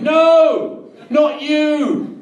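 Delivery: high, loud, slow wail of no; mother in the car crying out in horror; anguished cry. Retold or imitated. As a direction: howl it out in despair. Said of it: "all you can hear is her voice going".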